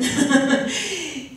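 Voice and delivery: a brief, real laugh with the audience; short real laugh. Real, not acted. New actress laughing with her moved audience at the premiere. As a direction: let a short laugh slip out.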